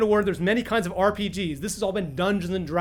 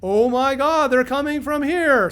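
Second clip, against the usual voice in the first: sudden realization; 7 semitones higher, 7 dB louder, slower than usual. Pretend. Characters' sudden realization, voiced.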